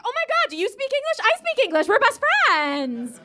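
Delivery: high, loud squealed excitement; overexcited gushing. Acted. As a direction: gush loudly, stretching the last words. Gushing at hearing English spoken.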